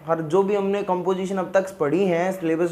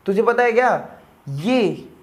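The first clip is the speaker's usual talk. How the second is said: ordinary talk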